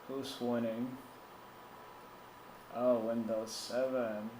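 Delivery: usual speaking voice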